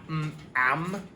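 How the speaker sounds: "am" with a humming nasal "mm" lead; "am" said with a hum; am sound demo. Shown as a demonstration. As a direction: glide "mm, am" through the nose. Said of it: "instead of going ah".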